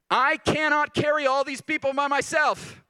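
loud, high cry of despair; Moses crying out. Retold or imitated. Preacher voicing Moses to the congregation, shouted high plea. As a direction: pitch it up and shout it to God as Moses. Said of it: "Let's look at what Moses said".

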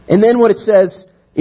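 ordinary talk, nothing marked

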